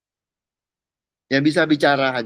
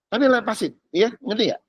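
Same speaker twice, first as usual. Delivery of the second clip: ordinary delivery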